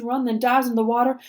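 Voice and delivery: ordinary delivery, nothing marked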